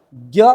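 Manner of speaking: ज्ञ said as "gya", the way the teacher rejects; ज्ञ dropped low and pushed out as "gya"; wrong way to say it. Shown as a demonstration. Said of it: "कभी भी नहीं".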